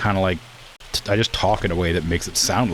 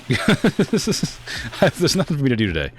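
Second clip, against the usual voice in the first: slow, higher-pitched laughing whine; laughing complaint; 8 semitones higher, slower than usual. Real, not acted. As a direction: draw out the complaint through a laugh. Laughing over having nothing to do.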